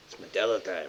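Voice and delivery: meme quote. Retold or imitated. Retelling how the internet quoted it, offhand. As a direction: drop the catchphrase casually. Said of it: "the internet's just".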